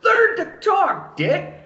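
very high, drawn-out exasperated squawk; mock outrage. Comic berating a long-settled immigrant, mock outrage. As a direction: blurt it out slowly in high-pitched disbelief.

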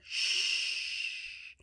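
long, hushed "shh"; a gentle drawn-out shush; soft shushing. Shown as a demonstration. Hushing babies before the soft hellos, soft shush. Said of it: "as softly as we can".

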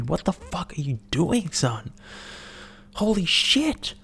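high, slow holy shit in mock horror; telling off the guy at the sauna, mock shocked. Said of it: "And I was like".

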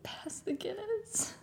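high, slow, hammed-up Irish accent. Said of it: "don't know what that was".

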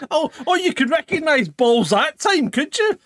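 high, loud teasing; mock outrage. Real, not acted. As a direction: tease him, pitch up.

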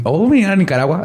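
jumps up high in surprise, drawing out Nicaragua; a sudden, delighted oh at the news; bright startled exclamation; surprised. Real, not acted.

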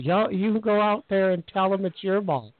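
comic impression of a woman egging someone on; odd put-on voice. Referee telling the young player it's his ball, comic voice. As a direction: put on a funny character voice for the official. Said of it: "I don't know why she sounded like that".

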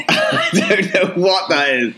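giggling at a failed accent; laughing. Real, not acted. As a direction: laugh through the words. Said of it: "laughing at own failure".

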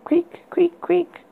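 high thin creak sounds; high squeaky creak. Acted. Creaking bed sound imitated.

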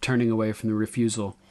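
ordinary delivery

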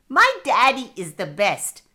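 put-on loud, delighted child's boast; excited exclamation. A narrator's reading. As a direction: burst out with pride in Daddy. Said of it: "exclaimed Cammy".

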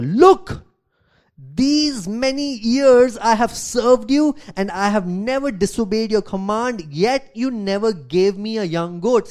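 loud, high, slow complaint; resentful protest. Acted. Older son shouts grievance at his father, indignant. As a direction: throw the grievance at him.

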